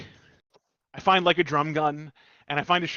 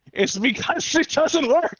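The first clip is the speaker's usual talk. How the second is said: mock exasperation; 10 semitones higher, 3 dB louder, much slower than usual. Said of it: "I'm like".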